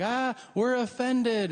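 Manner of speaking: high, slow, prissy offended voice; mock offended San Franciscan. Comic mocking San Francisco locals, offended and slow. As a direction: drag it out, hammed-up offense. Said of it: "They're like".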